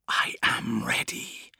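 slow hissing whisper; demonic whisper. Acted. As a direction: breathe it like a demon. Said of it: "hissed the voice".

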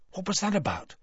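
hushed, high-pitched question; whispered. A narrator's reading. Ron to his friends about Borgin, whispered. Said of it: "whispered Ron".

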